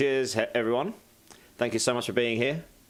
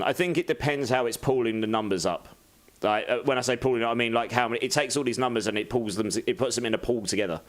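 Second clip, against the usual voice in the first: ordinary talk, nothing marked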